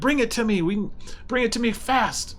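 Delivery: high-pitched, urgent goblin boss; impatient squeaky goblin demand; commanding goblin. Acted. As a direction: order the minion in a high goblin voice. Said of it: "the other one's like".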